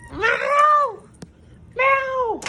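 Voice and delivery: cat meow. Retold or imitated. Imitating cat to pet.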